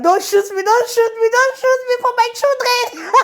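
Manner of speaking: high, squeaky, laughing plea; mock terrified pleading. Pregnant mom pleading with the officer, high laughing mock terror. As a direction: beg him not to shoot, half laughing. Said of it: "my hands were like this".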